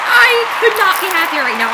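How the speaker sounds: high, loud, breathless joy; overjoyed. Real, not acted. Collector telling viewers how happy she is, high and loud. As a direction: still riding the thrill. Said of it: "I'm, like, getting really emotional".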